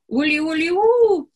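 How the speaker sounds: high, loud village shriek. Acted. Femeia țipă ascuțit la ulii. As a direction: shriek sharply at the hawks. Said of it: "țipă femeia ascuțit".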